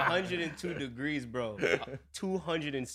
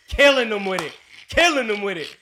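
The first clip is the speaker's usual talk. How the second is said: emphatic; 8 semitones higher, 11 dB louder than usual. Real, not acted. Marveling at bilingual coworker.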